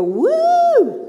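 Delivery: high whooping woo; playful whoop; mock cheer. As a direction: cheer like a fan. Mock cheer about computer.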